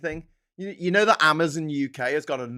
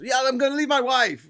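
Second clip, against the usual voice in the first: higher, goofy voice of a man leaving his wife; pretend naive husband; 10 semitones higher, 5 dB louder than usual. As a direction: play it dopey and pleased with yourself. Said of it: "if it was".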